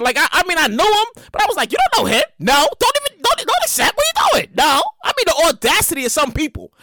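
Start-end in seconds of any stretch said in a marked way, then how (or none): 1.7-4.8 higher-pitched mock disbelief; exasperated scolding. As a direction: scold them in rising exasperation. Retold scolding of friend requests.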